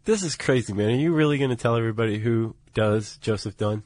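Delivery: ordinary delivery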